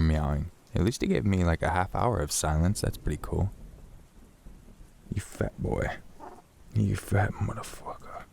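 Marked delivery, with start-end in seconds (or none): 5.1-8.2 soft, mostly voiceless tease; teasing the cat. Real, not acted. Streamer teasing his hungry cat, hushed mock insult.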